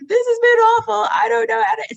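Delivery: high, loud imitated sobbing; crying husband. Husband imitated crying, high and loud. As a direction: wail it like a man breaking down. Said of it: "then he starts crying".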